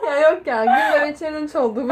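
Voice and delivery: loud giggly protest; laughing loudly through the words. Real, not acted. Laughs after failed breath hold.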